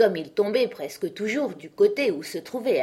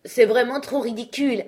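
higher and louder exasperated cry; losing patience; 6 semitones higher, 6 dB louder than usual. A narrator's reading. Alice losing patience with the knight: exasperated cry. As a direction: blurt it out, fed up. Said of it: "s'écria la fillette perdant patience".

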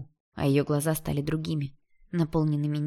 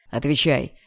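louder, short command; angry demand; 5 dB louder, slower than usual. A narrator's reading. Jealous stepbrother demands an answer, angrily. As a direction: demand it with restrained anger. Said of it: "потребовал он зло".

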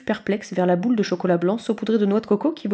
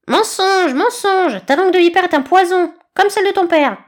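very high, loud squawk; shrieking eaglet; 10 semitones higher, 7 dB louder than usual. A narrator's reading. Eaglet screeches at the snake, very high. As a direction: screech the accusation.

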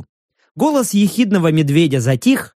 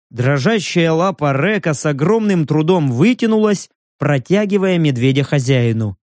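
ordinary delivery

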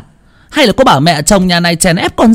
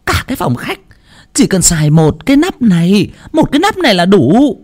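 very high, biting nag; nagging scolding; 10 semitones higher than usual. A narrator's reading. Mother-in-law nags her daughter-in-law about waste. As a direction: grind the reproach into her. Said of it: "Bà Tuyết đay nghiến".

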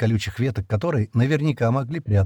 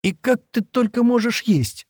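much higher, slower, outraged tearful cry; indignant exclamation; 13 semitones higher than usual. Acted. Shira, tear-stained, scolds Stan for eating, indignant. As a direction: reproach him, stunned he can eat. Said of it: "возмущённо воскликнула она".

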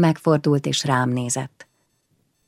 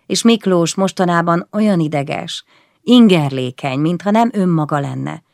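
ordinary delivery

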